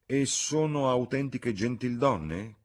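slow, high-pitched doubtful question; slightly wary, drawn-out query; a bit hesitant. A narrator's reading. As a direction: ask it with mild misgiving. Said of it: "chiese Sir Harry un po' titubante".

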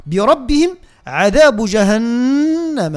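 slow chant with a long hum on the n of جهنم; drawn-out عذاب جهنم with humming ghunna; nasalised doubled nun. Shown as a demonstration. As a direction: recite and hold the nn in جهنم through the nose. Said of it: "عندنا النون المشدة أيضًا هنا تغن".

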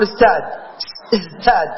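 "ازداد" as istād, the z hardened towards s before t; others' pronunciation. Shown as a demonstration. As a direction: show "ازداد" said with a t, as some people do. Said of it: "ينطقها هكذا".

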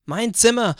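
loud, high outraged cry; shouting. A narrator's reading. Child protesting at the family dinner about sharing a room, shouting. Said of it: "schrie ich".

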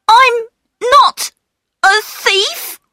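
put-on very high, halting boy's protest; unsure denial. A narrator's reading. Peter denying theft to the station master, high and unsure. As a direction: protest in a high voice, word by word, without conviction. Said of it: "he did not sound very sure about it".

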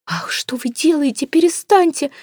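whispered gasp rising to a high cry; alarmed breathless cry. A narrator's reading. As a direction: panic at the sight. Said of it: "всполошилась миссис Флип".

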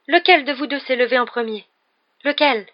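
high, loud, urgent questioning; alarmed demand. Acted. Seer asking who got up first, alarmed. Seer frantic after her piercing cry.

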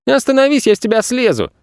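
high-pitched cheeky retort; boy shouting. A narrator's reading. Boy arguing back at planet Earth, shouting. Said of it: "крикнул Федя".

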